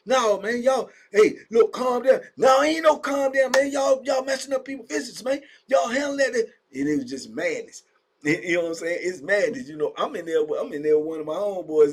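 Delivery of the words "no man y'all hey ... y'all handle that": high, loud heated shouting; loud high protest; furious yelling. Retold or imitated. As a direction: shout back at the officers, refusing to calm down. Inmates arguing with guards.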